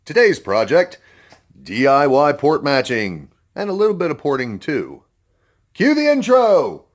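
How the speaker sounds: loud, high, excited show host; booming kids show opener. Acted. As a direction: announce it like a kids' TV host. Kids show style episode opening.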